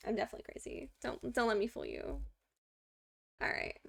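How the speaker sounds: ordinary talk, nothing marked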